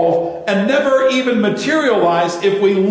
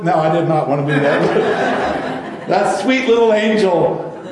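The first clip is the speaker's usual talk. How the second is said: laughing; 4 semitones lower than usual. Real, not acted. Joking he'd hit the child.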